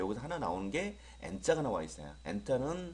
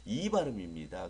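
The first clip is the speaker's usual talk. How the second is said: ordinary delivery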